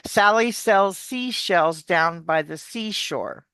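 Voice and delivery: slow, deliberate tongue twister. Shown as a demonstration. Coach repeating the tongue twister for student, slow. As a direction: say it slowly, every sound clear. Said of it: "she, she's".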